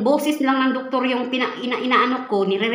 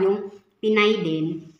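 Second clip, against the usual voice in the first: normal talking voice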